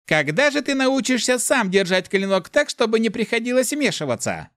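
very high, scolding demon voice; Mefis, haughty. A narrator's reading. Voice in the hero's head berating his swordsmanship, haughty. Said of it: "заносчиво прошелестел Мефис".

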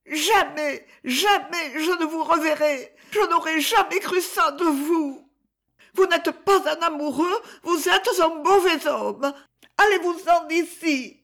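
put-on a girl crying as she sends him away; sobbing girl. A narrator's reading. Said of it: "Elle éclata en sanglots violents".

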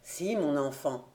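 low, soft, tender concession; subdued. Acted. Father agreeing with his angry child, subdued. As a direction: yield gently, voice dropped. Father yields to furious son.

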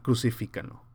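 usual speaking voice

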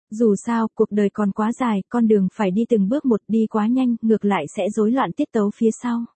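ordinary delivery, nothing marked

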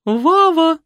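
high screeching call down the hall; shrill shriek. A narrator's reading. Mother screeching for her son from the corridor. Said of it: "заверещал в коридоре".

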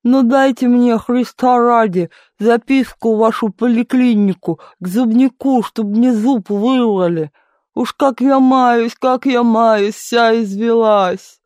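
high, drawn-out toothache moan; indistinct whining. A narrator's reading. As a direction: whine and plead slowly with a mushy mouth. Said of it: "Невнятным басом ныла она".

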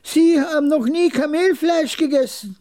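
put-on very high, astonished old man; high, disbelieving character voice; incredulous old trader. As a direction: exclaim it in a high-pitched old voice.

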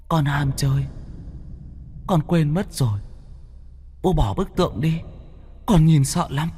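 high, soft, wheedling; eerie childish plea. A narrator's reading. Demon son wheedles the father, high and soft. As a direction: coax in a thin frightened voice.

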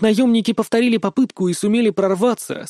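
plain reading voice

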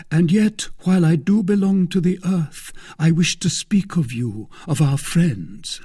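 high, lofty voice, starting loud and easing; earnest Aramis. Acted. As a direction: declaim it earnestly to a friend.

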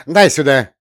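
loud barked order; commanding counselor. Acted. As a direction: snap the order at the boy. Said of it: "велел Костик".

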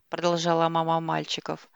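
ordinary delivery

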